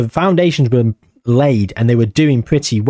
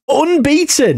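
"unbeaten" thrown up high in disbelief; gobsmacked; 12 semitones higher, slower than usual. Real, not acted. Gamer stunned, "unbeaten" said high in disbelief. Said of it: "I am absolutely gobsmacked".

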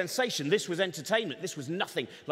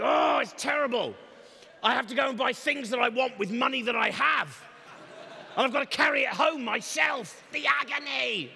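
melodramatic moaning; 4 semitones higher than usual. Retold or imitated. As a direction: wail about shopping as if it were torture. Modern whiner moaning about shopping.

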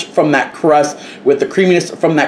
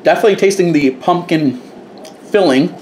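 chewing between words; talking around a bite; mouth partly full; 2 semitones higher than usual. Real, not acted. Seen 0: Bit pie at -4; cheeks still working while he talks.

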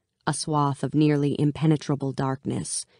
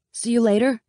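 higher, louder friendly call; 8 semitones higher, 4 dB louder than usual.

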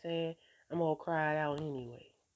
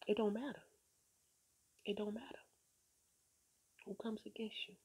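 fading to whisper; 6 semitones higher, 6 dB quieter, faster than usual. Real, not acted. Emphatic encouragement fading to whisper.

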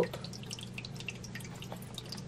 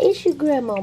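loud, much higher sing-song coax to a pet; high sweet calling; 12 semitones higher, 5 dB louder than usual. Real, not acted. A grandma calling her pet Brick Brick out of his shell, high and loud. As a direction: call him out like a doting grandma.

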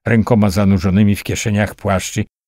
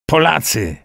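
roared alarm; 9 semitones higher than usual. A narrator's reading. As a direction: raise the alarm with an understated roar. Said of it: "ryknął Lejman". (wysoki okrzyk alarmu, stłumiony ryk)

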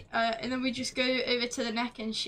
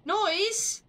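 startled; 8 semitones higher than usual. Real, not acted. Startled by a sound.

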